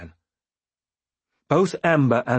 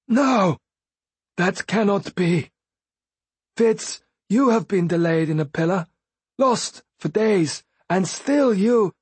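higher, slowed, breaking in despair; desperate Fool; 9 semitones higher than usual. A narrator's reading.

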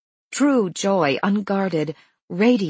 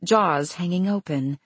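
ordinary delivery

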